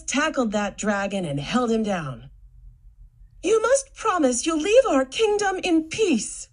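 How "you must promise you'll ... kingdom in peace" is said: commanding princess. Acted. Princess commands the pinned dragon.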